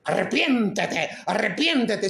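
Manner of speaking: slow, drawn-out stern call to repent; a hint of a scolding preacher; mock harsh preacher. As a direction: play the finger-wagging preacher, lightly. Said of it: "sin decirte".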